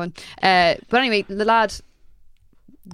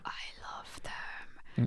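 whispering; 15 dB quieter, much slower than usual. Real, not acted. Whispered aside about horror films.

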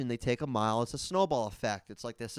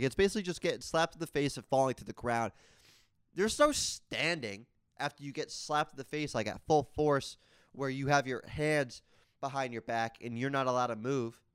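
ordinary talk

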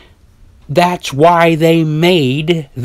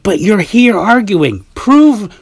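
high exasperated quote; 9 semitones higher than usual. Retold or imitated. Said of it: "it's like".

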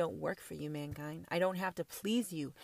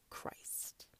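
whisper; 19 dB quieter than usual. Real, not acted. Whispered sacred name.

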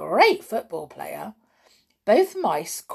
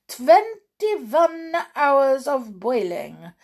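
slow, louder commanding witch; 6 semitones higher, 4 dB louder, much slower than usual. A narrator's reading. Grand High Witch to witches, slow commanding witch voice. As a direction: lord it over the crowd of witches.

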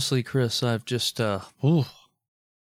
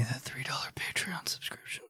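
hushed greedy whisper; 15 dB quieter than usual. Acted. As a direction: whisper it like a creepy plea. Begging listeners for money.

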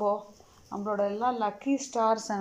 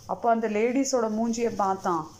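ordinary delivery, nothing marked

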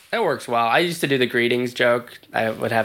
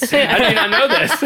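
laughing; 10 semitones higher than usual. Real, not acted. Joking mock outrage, laughing.

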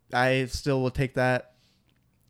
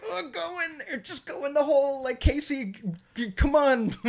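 excited high cheering; 13 semitones higher than usual. Retold or imitated. Cheering Casey on, retold.

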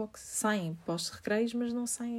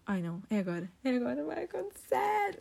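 inner voice going high with alarm; mock panic; 4 semitones higher than usual. She panics inwardly as the stranger touches the dog's collar.

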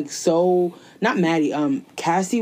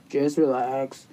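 low, subdued brush-off; mock dismissive boyfriend; 7 semitones lower, 4 dB quieter, slower than usual. Boyfriend to his crying girlfriend on the phone, mock dismissive. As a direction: imitate him brushing her off, pitched down and subdued. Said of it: "The way he was talking to her".

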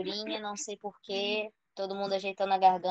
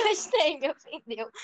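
laughing; 7 semitones higher, 3 dB louder than usual. Real, not acted. Echoes friend while laughing.

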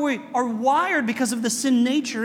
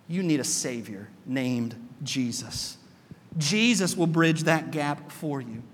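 solemn; 7 semitones lower than usual. Retold or imitated. Law speaking to Aaron.

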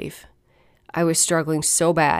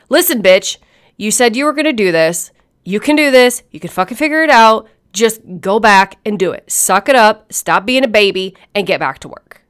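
louder, firm voice giving herself orders; tough self pep talk; 2 semitones higher, 5 dB louder than usual. Retold or imitated. Speaker talking back to their own self-doubt, firm and forceful. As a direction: imitate a stern inner coach, punching each command. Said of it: "on the other side of that conversation".

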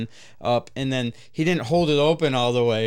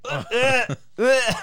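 higher mock retching sounds; imitating gagging; 10 semitones higher, 4 dB louder, slower than usual. Mimicking Zach retching over the dumpster, high mock gag. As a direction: fake the coworker's gag. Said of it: "spitting out puke".

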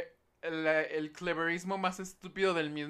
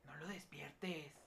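whispering over a crib; 12 semitones higher, 9 dB quieter than usual. Real, not acted. Baby asleep nearby.

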